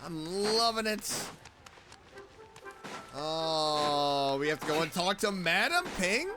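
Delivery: ordinary talk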